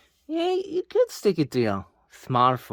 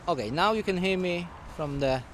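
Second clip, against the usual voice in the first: usual speaking voice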